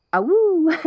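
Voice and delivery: a high, hooting woo like a howling choir; imitated wolf choir call. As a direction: lightly mimic a wolf-pack woo. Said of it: "it's kind of just".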